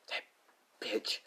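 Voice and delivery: soft, bitter whisper through clenched jaw; seething whisper. Acted. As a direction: spit it out under your breath. Ochako seething, fist squeezed.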